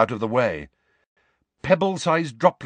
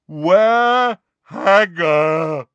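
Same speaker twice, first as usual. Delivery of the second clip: slow, loud, raised giant demand; roaring giant; 6 semitones higher, 8 dB louder, much slower than usual. A narrator's reading. Giant demanding his missing friend, slow roar. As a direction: roar each word out slowly. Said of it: "roared Grawp".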